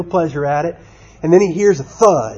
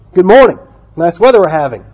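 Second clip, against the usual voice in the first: higher, louder storybook hello; friendly greeting; 4 semitones higher, 7 dB louder than usual. Acted. Brer Rabbit greets the silent tar baby, friendly greeting. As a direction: call out a polite hello.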